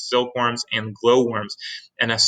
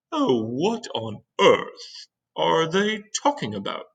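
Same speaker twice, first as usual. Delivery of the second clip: put-on puzzled old grasshopper; 6 semitones higher, slower than usual. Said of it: "the old green grasshopper said".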